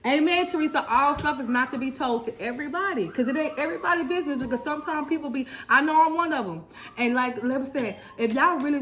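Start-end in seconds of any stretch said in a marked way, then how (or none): none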